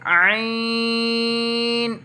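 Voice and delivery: "आइन" squeezed from the throat, held on a high note. Shown as a demonstration. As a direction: chant "आइन" long from the upper throat. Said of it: "हलक से". ("आइन" हलक से, लंबा खींचकर)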